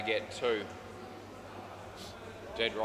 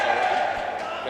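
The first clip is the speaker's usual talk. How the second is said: usual speaking voice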